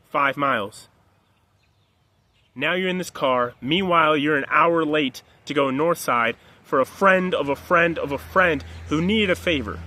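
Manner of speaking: ordinary talk, nothing marked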